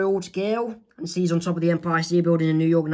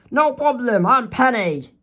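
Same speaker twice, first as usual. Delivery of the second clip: put-on higher, bright-toned teen girl reply; Penny's voice; 6 semitones higher, 3 dB louder than usual. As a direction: chirp a friendly introduction. Penny answers Wendy.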